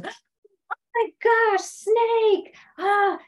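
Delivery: high, drawn-out scream of alarm; mock-terrified high cry with a gasp; acted panic. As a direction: shriek at a sudden danger, hammed up. Everybody reacting to a snake.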